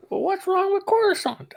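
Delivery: very high, small put-on voice; badly faked high-pitched character voice; Omega. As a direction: squeak it out as a character's question. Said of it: "I can't do her voice".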